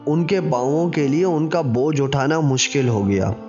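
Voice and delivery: ordinary delivery, nothing marked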